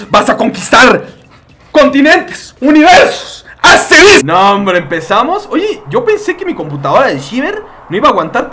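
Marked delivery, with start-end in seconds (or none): 0.1-4.2 rising louder and higher with each word; building hype. Pretend. Streamer hyping Chuchito before battle, building mock epic. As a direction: climb to a mock epic peak.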